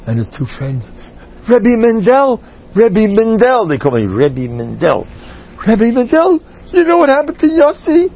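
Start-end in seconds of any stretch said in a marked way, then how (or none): 1.5-3.7 put-on high, loud little-boy call; kids calling out. 5.7-8.1 put-on high, loud child's voice; excited kid. Kids excitedly reporting.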